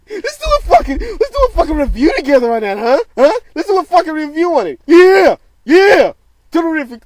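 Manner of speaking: shrieking very high and loud; hyped shouting. Real, not acted. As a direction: scream the challenge at a friend. Hyped challenge to friend Tyreek.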